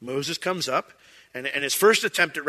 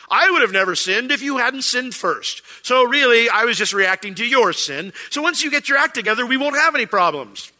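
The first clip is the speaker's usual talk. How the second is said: higher, louder self-righteous excuse; hammed-up defensive husband shifting blame; mock-indignant non-apology; 7 semitones higher, 6 dB louder than usual. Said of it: "my apologies normally sounded something along the lines of this".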